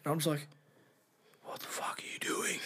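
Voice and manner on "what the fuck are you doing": hissed under the breath, urgent; shocked whisper. Retold or imitated. To the staff member spilling the secret, a frantic whisper. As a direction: whisper it so the girlfriend won't hear.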